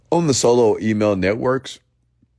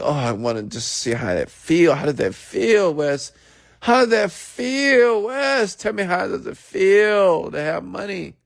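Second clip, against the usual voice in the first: high, slow whiny mock voice pestering Wes; mocking eager viewer; 12 semitones higher, slower than usual. Viewer nagging Wes about being rich, high whiny mockery. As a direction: ham up a pushy fan begging to know. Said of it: "some of you are like".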